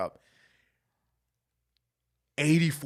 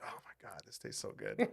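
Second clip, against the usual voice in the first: whispered, much softer savouring; hushed bliss over the food; 9 dB quieter than usual. Retold or imitated. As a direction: breathe it out like the best bite ever. Quoting himself savouring the food.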